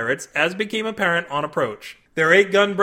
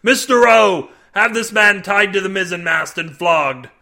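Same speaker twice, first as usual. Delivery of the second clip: loud, harsh command to flog him; lieutenant bellowing for a flogging; bellowed order; 4 semitones higher, 8 dB louder than usual. Acted. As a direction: bark it across the deck.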